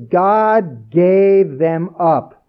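"God gave them up" spoken very slowly, every word weighted; slow emphasis. Shown as a demonstration. Preacher stressing the verse to his listeners, slow and weighty. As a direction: drag it out, heavy and solemn. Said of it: "uh, emphasis".

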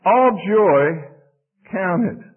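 ALL JOY loud and high, pause before "count it"; emphatic. Shown as a demonstration. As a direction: punch out ALL JOY, break, then "count it". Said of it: "But it is emphatic".

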